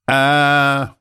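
high loud scream of pain. Acted. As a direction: cry out as the leg is stepped on. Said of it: "заорал от боли бандит".

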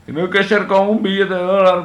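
más agudo y más fuerte, tono de locutor teatral; proclámalo como un presentador exagerado; voz de anunciante. Pretend. Repite la frase exagerándola. (voz de locutor exagerada)

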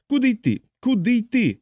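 a thin, panicked plea; panicked pilot. A narrator's reading. Pilot on the intercom, a high frantic question.

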